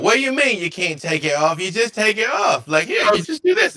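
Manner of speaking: put-on high, eager child voice; naive child. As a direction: pipe up with a child's puzzled insistence. Game master voices gnome child.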